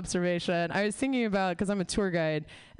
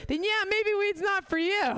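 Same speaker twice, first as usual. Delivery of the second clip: sarcastic punchline; 12 semitones higher than usual. Acted. Punchline to hippie.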